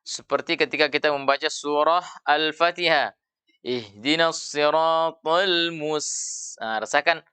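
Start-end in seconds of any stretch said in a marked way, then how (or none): 3.6-6.6 "mus" ending in a long hissed s; desis keluar. Shown as a demonstration. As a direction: recite "Ihdinas siratal mus-" slowly, drawing out the final s. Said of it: "ada desisnya". ("mus" dengan desis s panjang)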